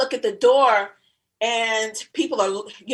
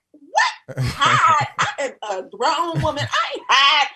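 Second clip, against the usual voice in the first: high, laughing indignation; indignant outburst; 4 semitones higher, 5 dB louder than usual. Retold or imitated. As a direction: scoff loudly, offended.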